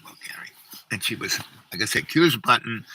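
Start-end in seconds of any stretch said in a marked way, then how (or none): 0.0-0.5 soft whisper. Retold or imitated. Gently naming her.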